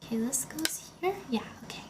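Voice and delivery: whispering to herself. Real, not acted. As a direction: whisper while focused on building. Muttering to self while building.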